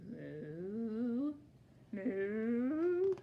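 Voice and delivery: lightly voiced lowing moos; cow moos. Teacher voicing the cow for her class, drawn-out moos. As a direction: moo like a calm cow.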